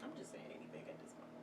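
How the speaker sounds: fast, breathless whisper aside. Real, not acted. To viewers, admitting she rambles: whispered aside. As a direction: toss it off under the breath.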